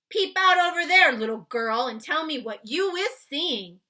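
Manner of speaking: big, loud friendly giant explaining. Acted. Giant explaining to Sophie why he took her. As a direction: boom it out like a kindly giant. BFG voiced in read-aloud.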